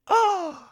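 very high, loud, over-the-top mock gasp; hammed-up gasp of pretend shock, shooting way up; fake dramatic gasp. Imitating the villain hearing the news, ridiculous fake gasp. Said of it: "does the fakest, most over-the-top, ridiculous, dramatic gasp".

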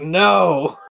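sudden loud mock "No!"; hammed-up panicked refusal; retold outburst. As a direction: bark it out like a panicked mother. Mother snatching the cane away.

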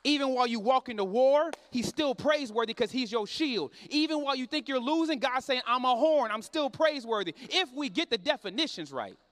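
loud, rhythmic pulpit preaching that hammers each phrase; preaching at full force. Acted. Preacher rousing the congregation to cry out to God, loud preaching. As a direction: preach it hard, rising to a shout.

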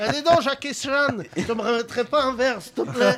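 a hint of a Chinese accent; mock Chinese accent. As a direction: tease a bar owner in a hammed-up Chinese accent. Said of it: "l'accent chinois".

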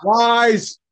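high, sharp cry of "lies"; accusing yell. Real, not acted. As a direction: shout the accusation. Denying the joke.

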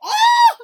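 sudden piercing excited cry; loud, high-pitched exclamation. Real, not acted. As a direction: let out a shrieked oh. Outburst over the double Freeman.

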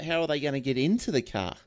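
ordinary talk, nothing marked

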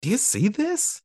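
put-on high, wide-eyed toddler wonder; amazed disbelief. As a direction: gasp it out like a stunned kid. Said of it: "this disbelief".

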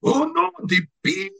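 high, hammed-up Spanish cartoon cat; mock-dramatic alarm in a Spanish accent; Antonio Banderas. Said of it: "he does the voice".

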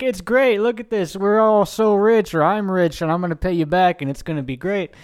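high, gleeful braggart voice; mock gloating Howard. Howard crowing to Arno and the goons, high and gleeful. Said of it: "and he's like".

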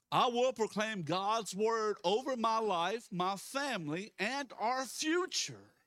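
loud, high, slow preacher's declaration; bold proclamation. Real, not acted. Pastor leading church to proclaim God's word, bold proclamation. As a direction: proclaim it over the whole room, stretching each phrase.

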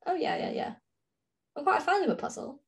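usual speaking voice

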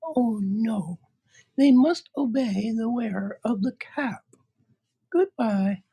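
very high, tiny mouse squeak; squeaky little voice. Acted. Queen of the Mice offering help, high squeak.

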